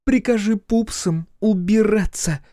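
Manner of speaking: high, slow female order; commanding. A narrator's reading. Olli to the captured hussar, commanding. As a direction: give a drawn-out command in a high voice. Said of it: "скомандовала Оли".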